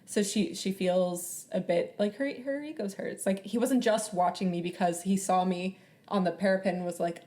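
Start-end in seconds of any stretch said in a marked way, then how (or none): none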